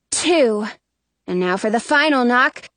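ordinary delivery, nothing marked

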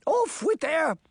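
very high queenly command; high-pitched outraged royal decree; imperious queen. A narrator's reading. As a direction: order an execution, high and cut short. Queen of Hearts orders executions.

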